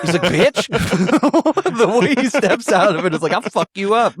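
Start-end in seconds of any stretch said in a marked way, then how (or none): 0.2-0.7 laughing mock retort. 3.3-4.1 mock tough threat. Imitating Tony's attitude.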